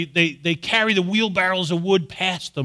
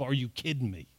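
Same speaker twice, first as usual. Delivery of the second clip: exasperated; 4 semitones lower, 10 dB quieter than usual. Retold or imitated. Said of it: "I use that on my kids".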